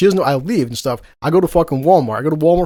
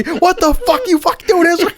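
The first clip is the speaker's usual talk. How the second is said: mock angry yell; 4 dB louder than usual. Girlfriend woken by prank, yelling.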